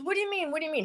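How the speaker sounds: high, hushed mock disbelief; shocked question. Ex-boyfriend's shocked reaction retold.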